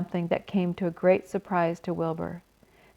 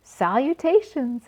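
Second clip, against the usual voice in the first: small voice; 5 semitones higher than usual. Acted. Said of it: "he heard a small voice".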